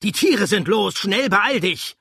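loud, high, frantic warning; high-pitched shouted alarm, last words stretched; urgent shout. A narrator's reading. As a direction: yell to hurry someone along. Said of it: "rief er".

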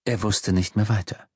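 ordinary delivery

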